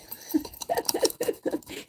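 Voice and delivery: whispered giggle; breathy laugh. Real, not acted. Laughing at own impression.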